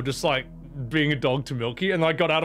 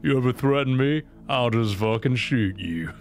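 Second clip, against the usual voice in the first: Uber driver's voice; 2 semitones lower than usual. Retold or imitated. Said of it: "how do I do his voice again?".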